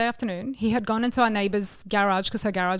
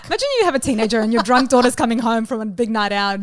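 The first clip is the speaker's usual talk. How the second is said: usual speaking voice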